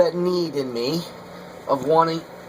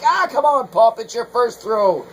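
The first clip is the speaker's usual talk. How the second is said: much higher, louder teasing son voice; mock the eager son nagging him on; imitating his son; 8 semitones higher, 6 dB louder than usual. Son teasing dad's first throw.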